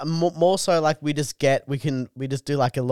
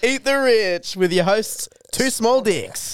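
podcast host intro; 6 semitones higher, slower than usual. Pretend. Announcing podcast name, slow high.

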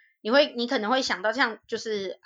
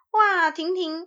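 aunt praising; 8 semitones higher, slower than usual. Retold or imitated. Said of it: "她就夸我说".

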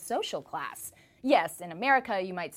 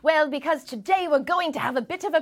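mock British TV presenter, pitched up and emphatic; English accent; 3 semitones higher, 6 dB louder than usual. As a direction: put on a big plummy English accent for the telly. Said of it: "Why am I talking like this".

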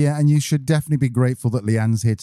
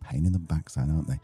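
upbeat sponsor read; 3 semitones higher, 3 dB quieter than usual. Acted. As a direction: read it like a radio spot. Scripted ad read by cohost.

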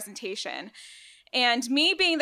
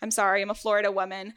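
normal talking voice

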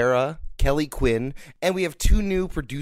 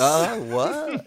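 mock surprise; 5 semitones higher than usual. Reacting to divorce joke.

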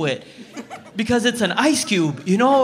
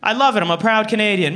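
normal talking voice

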